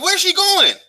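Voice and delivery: high-pitched confused question; he can't believe she just left; baffled. Retold or imitated. Left holding nothing at the bar, high baffled question. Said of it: "I'm like, thinking".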